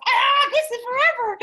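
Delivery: high, loud wail of sudden realization; hammed-up horrified outburst; mock panicked scream. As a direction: shriek it in comic alarm. Acts out sudden alarmed realization.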